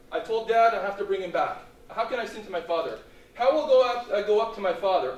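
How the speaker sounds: loud, high, desperate plea; pleading. Acted. Brothers begging Joseph for Benjamin, loud pleading. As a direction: plead urgently at full voice. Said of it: "the brothers were pleading before Joseph".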